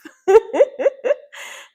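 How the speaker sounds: loud high burst of laughter. Real, not acted. Laughing loudly at the friend's hint that they weren't doing enough.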